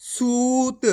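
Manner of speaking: सूत with a long held ऊ; long u demo. Shown as a demonstration. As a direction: hold the ऊ in सूत. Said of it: "इसी तरीके से पढ़ना आपको".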